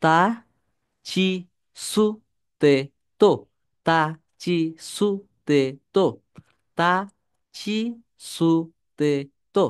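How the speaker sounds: one syllable at a time, pausing after each; separate, evenly spaced syllables; repeat-after-me drill. Shown as a demonstration. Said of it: "আমার সাথে সাথে করতে পারেন".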